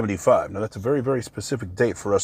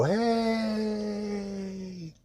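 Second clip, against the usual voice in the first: high, soft, limp little greeting; mocking a lazy Air Force type; mock lazy airman; 10 semitones higher, 8 dB quieter than usual. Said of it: "I think they just go".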